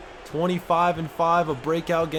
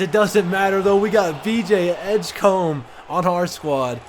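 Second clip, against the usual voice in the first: loud, raised voice slowing on the name; excited hype call; 4 semitones higher, 5 dB louder than usual. Real, not acted. Gamer hyping his rookie after a big shot, excited. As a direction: burst out, then stretch the player's name proudly. Cheering a clutch shot by Edgecombe.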